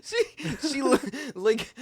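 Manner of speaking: laughing through the words; real laughter breaking in. Real, not acted. Laughing at the wife's remark.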